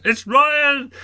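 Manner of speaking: a tiny high squeak from a giant costume; small high voice. Retold or imitated. The giant Transformer answers in a tiny high voice. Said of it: "And I just heard".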